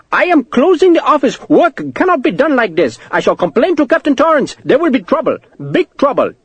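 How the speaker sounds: put-on very high, accented, flustered protest; agitated clerk. Clerk threatening to complain to captain, agitated.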